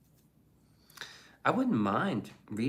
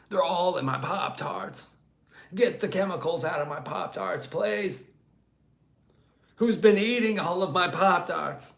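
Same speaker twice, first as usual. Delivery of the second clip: raised, louder strained mock politician voice; hammed-up rant about Pop-Tart chemicals; RFK impression; 8 semitones higher, 5 dB louder than usual. Streamer doing the requested RFK Pop-Tart bit, strained mock rant.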